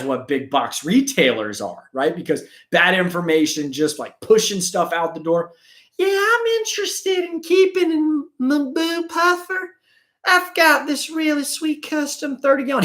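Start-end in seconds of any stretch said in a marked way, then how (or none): 6.0-12.8 much higher, naive hobbyist voice; eager newbie customer. Retold or imitated. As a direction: sound like a clueless eager buyer. Naive customer asking seller.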